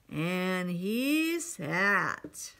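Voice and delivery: very slow, stretched-out narration; slow drawn-out. A narrator's reading. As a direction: drag out each word, heavy and tired. Repeated line, slow drawn out.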